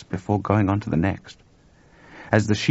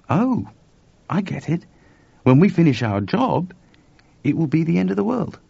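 higher-pitched dawning realisation; George catching on; 9 semitones higher than usual. Acted. George realises what finishing the job means, wondering. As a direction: work it out aloud, lifting the pitch.

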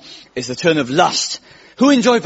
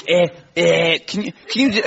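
Scouse accent; 2 semitones higher than usual. Shown as a demonstration. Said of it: "that's how you do a Scouse accent".

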